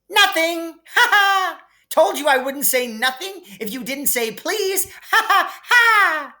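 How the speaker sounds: high, loud mocking laugh through the words; gleeful taunting cackle. A narrator's reading. Peeves mocking Filch after he said please, cackling high and loud. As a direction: crow with laughter at the trick.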